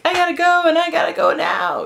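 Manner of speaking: very high, squealed dog voice; urgent dog's voice. Retold or imitated. Rocky begging his owner to go out, comic urgency. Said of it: "He's like".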